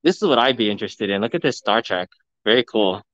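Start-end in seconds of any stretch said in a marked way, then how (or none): none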